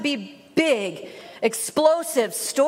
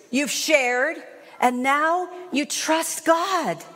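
usual speaking voice

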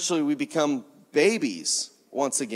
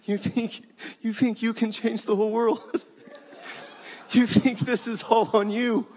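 high, chuckling disbelief; amused God; 5 semitones higher than usual. Acted. God to believers who think it depends on them, laughing coo. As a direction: tease them as if they were adorable toddlers.

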